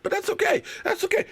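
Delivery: tiny high falsetto, softly mocking; soft, high-pitched mock nonchalance; sarcastic acceptance. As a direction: squeak out fake agreement. Sarcastic reassurance about rival quarterback.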